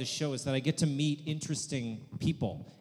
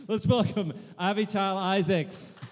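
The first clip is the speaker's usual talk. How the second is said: slow, loud, high host intro; big stage welcome; announcer welcome; 8 semitones higher, 9 dB louder than usual. Real, not acted. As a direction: announce the guest with a flourish.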